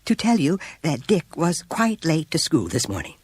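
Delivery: high, nervous teacher voice; plunging right in. A narrator's reading. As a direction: blurt it out quickly. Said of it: "Miss Robbins plunged right into it".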